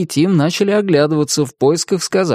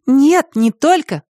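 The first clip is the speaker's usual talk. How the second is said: smiling; 14 semitones higher, 4 dB louder, slower than usual. Acted. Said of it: "улыбнулась Катя".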